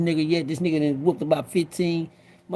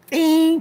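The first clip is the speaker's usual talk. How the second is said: a high, sharp ding, like a switch flipping; high ding sound effect; 11 semitones higher than usual. Retold or imitated. Telling inmates how he snapped, a high ding. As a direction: flick the switch with a ringing ding. Light switch flips in him.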